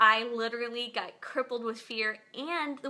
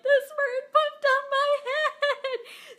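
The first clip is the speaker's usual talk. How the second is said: laughing; 13 semitones higher, 6 dB louder, much slower than usual. Retold or imitated. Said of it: "I'm like".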